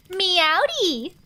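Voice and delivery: high, loud playful cat greeting; bouncy Meowdy call; cheery meow greeting. Real, not acted. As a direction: sing out the cat pun hello.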